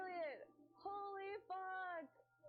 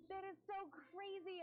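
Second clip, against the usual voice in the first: ordinary talk, nothing marked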